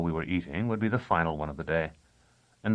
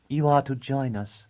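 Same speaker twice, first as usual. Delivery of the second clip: cooing woman; 6 semitones higher, 4 dB louder than usual. A narrator's reading. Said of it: "Thea said at last in her cooing voice".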